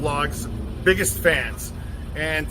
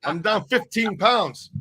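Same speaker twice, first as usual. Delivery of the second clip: normal talking voice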